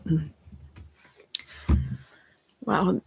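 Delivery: ordinary talk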